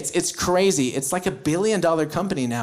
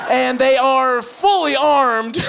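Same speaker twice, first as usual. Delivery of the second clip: hyped anchor; 5 semitones higher, 4 dB louder than usual. Retold or imitated. Hyped American news anchor.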